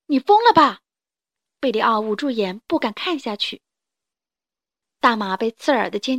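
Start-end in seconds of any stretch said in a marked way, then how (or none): 0.1-0.8 high, loud horrified cry; alarmed shout. Acted. Friend watching rooster face the charging horse, alarmed shout. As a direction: yell it in disbelief.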